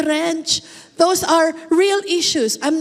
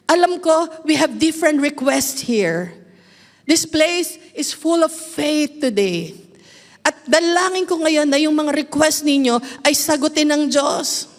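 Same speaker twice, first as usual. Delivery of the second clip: ordinary talk, nothing marked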